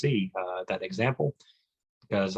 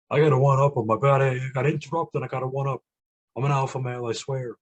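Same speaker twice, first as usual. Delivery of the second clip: higher-pitched mocking braggart voice; fake alpha male; 4 semitones higher, 3 dB louder than usual. Mocking a one-upper who interrupts with alpha male boasts, put-on braggart. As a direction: butt in like an insecure one-upper. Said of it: "your fake alpha male bullshit".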